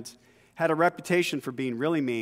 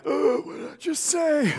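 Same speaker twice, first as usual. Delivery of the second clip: much higher, startled squeak; shocked at himself; 11 semitones higher than usual. Retold or imitated. Boy stunned after yelling at the bully, high startled squeak. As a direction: gasp at your own nerve. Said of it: "And then I was like".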